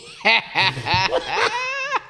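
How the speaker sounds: bursting into a loud, high laugh; a big real belly laugh, way up high. Real, not acted. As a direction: laugh out hard at the memory. Cracks up at his own story.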